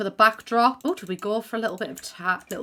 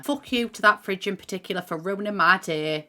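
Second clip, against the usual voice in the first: ordinary talk, nothing marked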